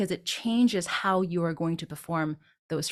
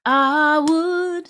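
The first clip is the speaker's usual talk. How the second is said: I, beat, would, louder and higher; pause between I and would; 8 semitones higher, 7 dB louder than usual. Shown as a demonstration. Teacher shows the pause in the phrase. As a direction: leave a beat after I. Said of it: "a little bit of a pause there".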